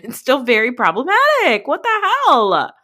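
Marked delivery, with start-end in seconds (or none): 1.7-2.7 high, slow, incredulous What the hell; stunned outburst. Real, not acted. Reacting to a problematic TV moment, high incredulous outburst. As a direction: throw it out in disbelief, drawn out.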